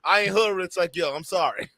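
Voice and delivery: ordinary talk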